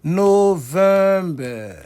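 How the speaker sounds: NOVEMBER very slow, loud and high; word-ending demonstration. Shown as a demonstration. Teacher showing the -ber ending, loud drawn-out syllables. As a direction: hold each syllable of NOVEMBER long and loud. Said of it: "se terminent comme ça".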